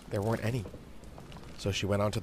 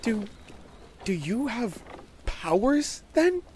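put-on high, halting child's question; insistent little girl, stumbling into the question; demanding young girl; 4 dB louder, slower than usual. A narrator's reading. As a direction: press the friend for an answer. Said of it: "she demanded".

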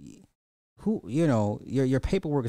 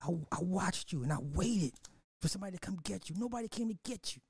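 softer, a touch higher, mother confessing; quiet confession; 5 semitones higher, 6 dB quieter than usual. Retold or imitated. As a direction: let it drop to a hush as she admits it. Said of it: "She was like".

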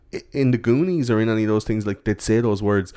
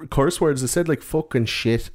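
ordinary delivery

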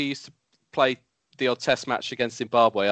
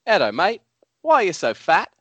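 higher, sweetly mock-polite question; 8 semitones higher, 3 dB louder than usual. Bowler to batsman, in a mock-polite higher voice. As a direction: ask it with false politeness, pitched up. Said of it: "just politely asked Edo".

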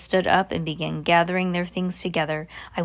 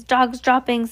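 shouting; 5 semitones higher, 5 dB louder, slower than usual. Acted. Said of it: "they shouted".

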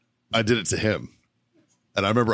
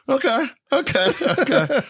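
high squeaky mock "okay, okay"; mock officer okay; 4 dB louder than usual. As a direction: pitch the okays way up, mocking the officers. Officers react to his excuse.

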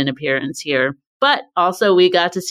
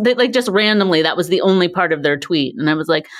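ordinary talk, nothing marked

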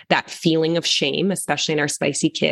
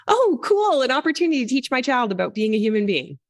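bright, much higher burst of delight; cheery mock delight; 6 semitones higher, faster than usual. Parent sees a mistake as a teaching chance, delighted. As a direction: gush like it's a gift. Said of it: "you're like".

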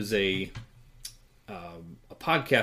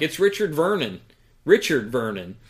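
higher, louder mock of the woman; retelling a bad podcast, raised mock announcement; mocking the girl; 5 semitones higher, 6 dB louder than usual. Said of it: "She's like".

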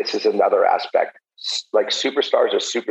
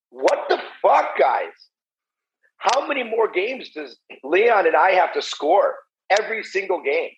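higher-pitched, exasperated mock yell at teammates; mock locker-room yelling; 7 semitones higher than usual. As a star player to his entire team, mock yelling. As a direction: act out a fed-up rant without real shouting. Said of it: "I'm yelling at the, my entire team saying".

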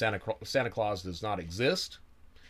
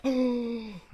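dismayed gasp; 14 semitones higher than usual. Retold or imitated. Bobby gasps in dismay.

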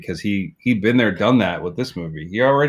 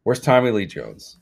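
normal talking voice

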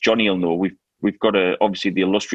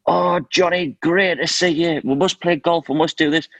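higher-pitched mock effusive welcome; gushing greeting; 8 semitones higher than usual. David O'Leary hailing Johnny at his car, gushing. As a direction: imitate an over-keen famous acquaintance greeting a friend.

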